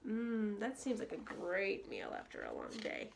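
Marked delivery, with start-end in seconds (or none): none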